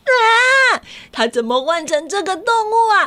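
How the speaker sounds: high delighted gasp; delighted surprise. Real, not acted. Reacts to the reveal.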